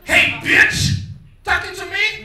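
loud, high outraged yell; offended yell. Retold or imitated. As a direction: take offence at the beach. Someone offended on the beach.

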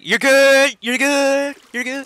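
very high, loud cartoon holler; SpongeBob bit. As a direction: shriek it out like a cartoon sailor. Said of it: "like that SpongeBob thing".

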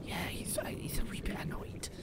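hushed whisper. Real, not acted. Whispering so the sea dragon won't hear. Whispering about the angry monster.

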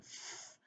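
a long voiceless f, lip on teeth; f sound. Shown as a demonstration. Teacher showing a child the digraph sound, an unvoiced f. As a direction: hiss out the f with no voice. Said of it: "That makes the F sound".

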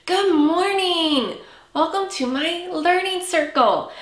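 bright singsong teacher greeting; singsong kids theatre. Acted. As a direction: greet little ones warmly.

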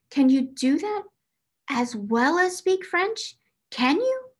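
building to a high, loud amazed cry. A narrator's reading. Ermengarde to Sarah, rising into a high amazed cry. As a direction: astonishment climbing to a squeak.

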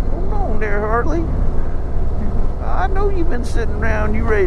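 playful coaxing of the motorcycle; excited banter. Real, not acted. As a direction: grin through it as it pulls. Talking to the bike, thrilled.

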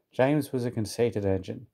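ordinary delivery

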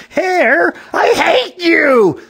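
high, loud, drawn-out angry yell. A narrator's reading. Lady yelling at the hair on her, angry yell. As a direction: yell hatefully at the top of the voice. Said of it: "The lady yelled".

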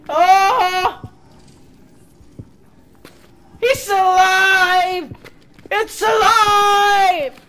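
high, loud, drawn-out scream; frightened shout. Real, not acted. Player finds it alive, frightened shout. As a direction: shriek it slowly in alarm. Terrified by creature coming alive.

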